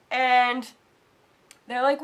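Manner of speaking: ordinary talk, nothing marked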